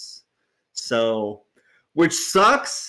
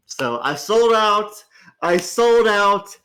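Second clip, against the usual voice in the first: slow, higher, louder self-mocking cry; loud mock confession; 4 semitones higher, 5 dB louder, slower than usual. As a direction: wail it like a guilty confession. Confessing selling out, dramatic.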